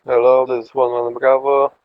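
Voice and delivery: ordinary talk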